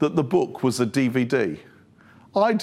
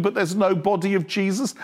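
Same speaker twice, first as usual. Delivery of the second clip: usual speaking voice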